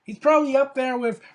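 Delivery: excited. Real, not acted. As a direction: gush about the match. Excited about AJ Styles.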